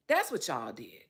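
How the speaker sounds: ordinary talk, nothing marked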